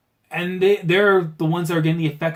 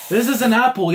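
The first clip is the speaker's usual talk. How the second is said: louder, higher, disbelieving; stunned rereading of the line; incredulous repeat; 8 semitones higher, 6 dB louder than usual. Real, not acted. Reacting to the manga, repeating the line in disbelief.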